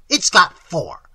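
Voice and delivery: loud, slow, emphatic retort; sharp retort. A narrator's reading. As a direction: hammer it home loudly. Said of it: "she retorted".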